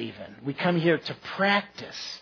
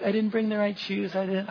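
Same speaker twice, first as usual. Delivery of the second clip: higher, put-on whiny excuse voice; mock a reluctant guy begging off; hammed-up complaining man; 5 semitones higher, 3 dB quieter than usual. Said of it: "And they're like".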